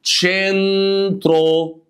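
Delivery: "تشينترو" very slow, high, as "cheeen-tro"; demonstrated ch sound. Shown as a demonstration. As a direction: hold the first syllable of "تشينترو". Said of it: "هتقول ايه؟".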